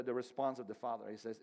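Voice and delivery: usual speaking voice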